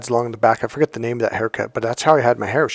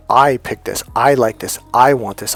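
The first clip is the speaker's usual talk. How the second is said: louder, each "I" stamped down hard; firm claiming; 6 dB louder than usual. Shown as a demonstration. Showing men how to tell a girlfriend the shirt is theirs, loud and firm. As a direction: claim the shirt as your own choice. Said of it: "you have to do it like that".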